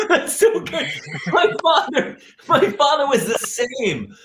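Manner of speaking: high voice through laughter; helpless laughter, words drawn out at the end; laughing. Real, not acted. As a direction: talk while cracking up. Cracking up at other man's story.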